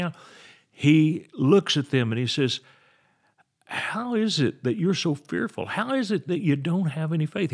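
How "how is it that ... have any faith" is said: higher-pitched question without yelling; raised pitch, unforced, confident questioning; Jesus. Acted. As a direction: ask it pointedly, volume held to normal. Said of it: "he doesn't yell at them".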